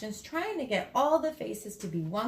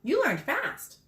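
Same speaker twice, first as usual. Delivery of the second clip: impressed; 8 semitones higher than usual. Acted. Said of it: "Gino exclaimed".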